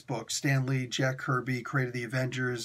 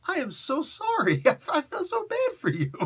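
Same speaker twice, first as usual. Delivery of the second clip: much higher, giggling through a hammed-up sorry; laughing mock apology; 3 semitones higher than usual. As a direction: pretend to console a friend while cracking up. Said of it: "I just wanna go up and give that dude a hug".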